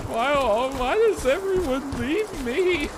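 high, slow, hammed-up wail; mock lament. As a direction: whine it like an abandoned child. Lonely monster lamenting.

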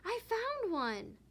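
high, slow, thrilled I found one; excited cry. Acted. Little kitten Dottie shouting about her egg, excited. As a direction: call out the find with delight. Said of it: "Dottie cried".

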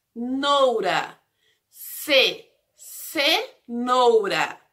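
"cenoura" slowly split after "ce"; syllable by syllable. Shown as a demonstration. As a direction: isolate "ce", pause, then finish "cenoura". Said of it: "Começa com a sílaba".

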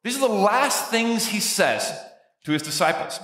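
usual speaking voice

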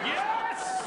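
a jump for joy in one word; triumphant yes. Retold or imitated. Guest thrilled by the promised investigation, gleeful yelp.

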